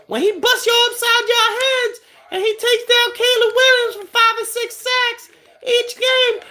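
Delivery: squeaky hyped alter ego roasting a rival fan; cartoonish high voice mocking a Bears fan; Baby Bart voice. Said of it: "Don't mind Baby Bart".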